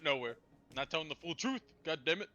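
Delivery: ordinary talk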